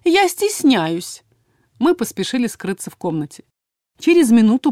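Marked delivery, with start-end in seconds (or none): 0.0-1.2 high put-on girly voice; hammed-up thin voice. A narrator's reading. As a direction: squeak it out in a fake falsetto. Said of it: "постарался сказать наигранно тонким голоском".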